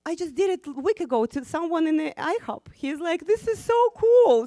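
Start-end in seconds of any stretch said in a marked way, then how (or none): 0.1-2.7 1 excited retelling. Retold or imitated. Man excitedly tells his story. 3.3-4.5 1 delighted exclaim. Retold or imitated. Man delighted by the kindness.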